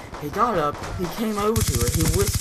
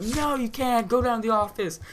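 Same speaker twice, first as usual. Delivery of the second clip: mock screaming; 7 semitones higher than usual. Teacher refuses student asking for a backpack, mild mock scream. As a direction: imitate a restrained scream of refusal. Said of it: "she starts screaming off".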